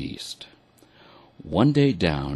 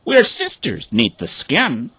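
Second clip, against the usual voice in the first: high, louder duck voice; declaring ducks; 9 semitones higher, 4 dB louder than usual. A narrator's reading. Ducks declaring sisterhood to the hens, high. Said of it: "the ducks declared".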